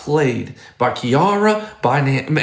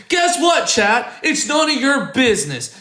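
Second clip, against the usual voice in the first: heated high rant; 9 semitones higher than usual. Real, not acted. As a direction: pitch shoots up, sharp and indignant. Escalating rant at the couple.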